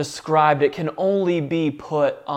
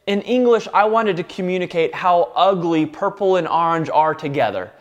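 normal talking voice

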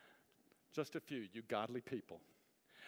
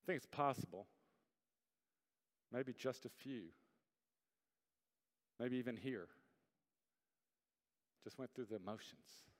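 low, soft, slow delivery with long pauses; quiet, weighty pulpit reflection; hushed solemn preaching; 6 semitones lower, 7 dB quieter than usual. Real, not acted. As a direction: let each phrase sink in gravely.

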